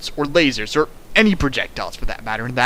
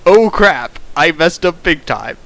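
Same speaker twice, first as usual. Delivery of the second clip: louder mock "oh crap"; hammed-up panic; 6 dB louder than usual. Reader voicing the bear's blunder, hammed-up and louder. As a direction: belt it like a sitcom oops.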